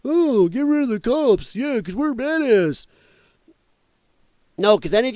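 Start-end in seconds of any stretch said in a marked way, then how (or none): 0.1-2.8 high, put-on voice of a cocky protester; mocking protester voice. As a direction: sneer it in a higher whiny voice. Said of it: "You think".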